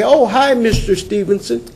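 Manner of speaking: high, bright friendly hello; cheery greeting. Retold or imitated. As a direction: greet him like a happy neighbor. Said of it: "they say".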